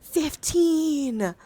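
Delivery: loud, high, drawn-out shout; big high announcement falling at the end; excited roll call. Real, not acted. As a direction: belt out the dice result. Calling out a big dice roll.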